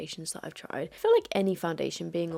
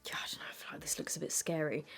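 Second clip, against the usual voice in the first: whispering; 4 semitones lower, 8 dB quieter than usual. Real, not acted. Whispered close-up inspection.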